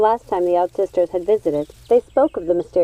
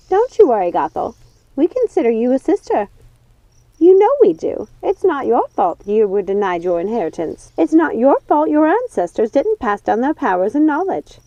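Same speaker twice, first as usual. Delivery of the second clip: high, coaxing witch voice; comforting witch; 5 semitones higher, 4 dB louder than usual. Acted. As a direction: soothe a friend who feels worthless. Lucinda comforts Gothel.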